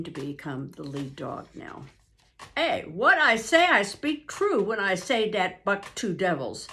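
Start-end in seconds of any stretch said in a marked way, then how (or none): 2.6-6.7 Francois in a lively French-Canadian voice, louder; a hint of a French-Canadian dog driver; French-Canadian accent, a touch louder. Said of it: "This was Francois' speech".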